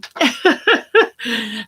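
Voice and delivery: light chuckle at own joke; a short, rippling giggle. Real, not acted. Joking to viewers about the turkeys, light chuckle.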